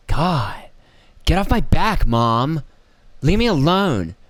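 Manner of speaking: put-on high, exasperated whine of a nagged kid; mock a teenager fed up with their mother; bratty kid impression. Kid told off by mom, exasperated mock whine. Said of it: "That's how she sounded".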